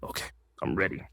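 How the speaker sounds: low, hushed, quick self-talk; quiet resolve. Retold or imitated. Actor readying himself alone before the read, low and hushed. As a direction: say it under your breath, retold.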